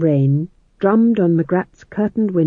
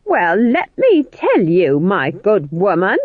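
slow, high, louder put-down; haughty scolding; 3 semitones higher, 4 dB louder, slower than usual. Acted. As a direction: talk down to her, slowly. Witches quarrelling.

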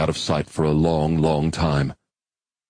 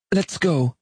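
high, brisk female character voice; brief him calmly, a pitch higher; woman's voice; 11 semitones higher than usual. Acted. Molly dives.

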